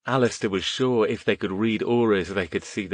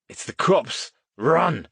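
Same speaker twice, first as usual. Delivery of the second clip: yell; 7 semitones higher, slower than usual. A narrator's reading. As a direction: warn the others and bolt. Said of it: "Green Aura yelled".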